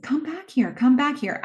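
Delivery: a high, lightly voiced little boy calling; a hint of a creepy boy calling after her. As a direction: coax her back like a creepy child. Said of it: "He's speaking in a boy, little boy voice".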